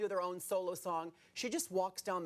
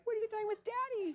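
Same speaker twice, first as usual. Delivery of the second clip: very high-pitched imitation of a little daughter; mock child's voice, pitched way up; worried daughter. Daughter confronting the singer about her dad, mock high child voice. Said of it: "I know his daughter was like".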